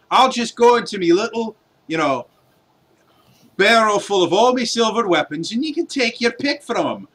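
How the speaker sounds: mock the party's request, dripping sarcasm; sarcastic dwarf. Dwarf mocking the witch hunter's price, sarcastic brogue.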